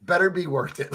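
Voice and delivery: ordinary delivery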